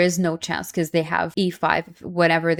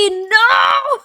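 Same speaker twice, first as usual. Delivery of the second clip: anguished shout; 13 semitones higher, 5 dB louder than usual. Real, not acted. Last second of the game.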